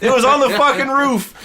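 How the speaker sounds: high, loud, amazed ranting; excited rant. Real, not acted. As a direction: rant with disbelief. Ranting in disbelief at movie.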